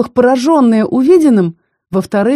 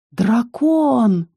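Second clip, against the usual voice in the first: squeezed out; 4 semitones higher than usual. A narrator's reading. Ros squeezes out the word at the sight of the dragon, shaken. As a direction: force the word out in shock. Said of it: "выдавил Рос".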